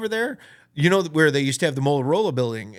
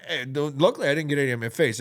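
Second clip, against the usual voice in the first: ordinary talk, nothing marked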